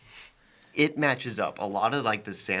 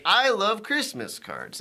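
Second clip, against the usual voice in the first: high, slow and sugary; chirpy sweet girl voice; 10 semitones higher, slower than usual. Retold or imitated. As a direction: gush it like a cheerful girl. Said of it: "And she's like".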